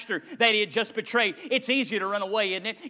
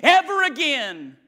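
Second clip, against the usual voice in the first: vengeful shout; 5 semitones higher, 7 dB louder than usual. Retold or imitated. Voicing vengeful betrayed churchgoer.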